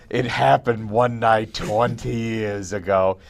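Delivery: slow, hammed-up flashback intro; flashback storyteller. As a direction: draw it out like a movie flashback. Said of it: "you do that flashback music".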